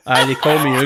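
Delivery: a hint of Scottish accent, Hugh with no h; lightly voiced Scottish manager, Scottish accent; light Scottish accent quoting Hugh. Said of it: "That's what he told us".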